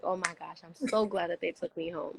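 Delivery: soft, grateful sigh of relief; hushed, thankful hangover voice; relieved morning-after voice. Pretend. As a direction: murmur it like you just woke up glad. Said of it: "you'll wake up the next day in your bed like".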